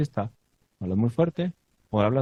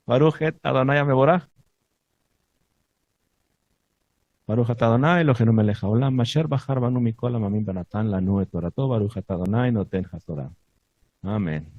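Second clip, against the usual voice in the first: recited Hebrew blessing. Acted. Teacher leading the prayer before the portion, recited blessing. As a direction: intone the blessing, starting strong and easing down.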